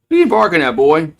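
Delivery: high, loud scolding call; yelling at the dog. Real, not acted. Owner shouting at his barking dog, loud and high. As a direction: bark back at the dog to hush it.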